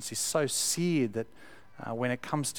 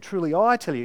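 higher and louder, every word weighted; emphatic quote; 6 semitones higher, 8 dB louder than usual. Retold or imitated. Preacher voicing Jesus to the congregation, emphatic delivery. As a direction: land it with full emphasis. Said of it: "It's emphatic".

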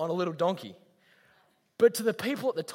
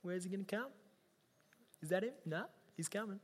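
eager hushed wondering; 16 dB quieter, faster than usual. Retold or imitated. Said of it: "everyone's just waiting, going".

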